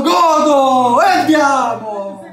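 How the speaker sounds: yelling in joy after a goal; celebratory shout. Real, not acted. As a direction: shout it out, triumphant.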